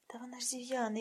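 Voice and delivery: softly whispered lament; whispered pity. Acted. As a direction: whisper it like sad news.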